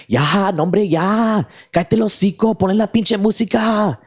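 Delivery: high mock drunk woman yelling in Spanish. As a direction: heckle the comic like a drunk aunt. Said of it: "she comes in and she's like".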